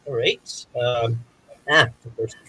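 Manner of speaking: normal talking voice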